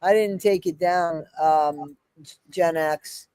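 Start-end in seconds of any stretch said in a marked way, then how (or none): none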